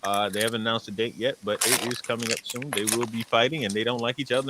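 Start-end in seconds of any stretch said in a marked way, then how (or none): none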